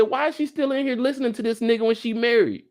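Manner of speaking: high-pitched indignant questioning; scandalized. Acted. Commentator asks why a married woman listens, scandalized. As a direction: demand it in mock outrage.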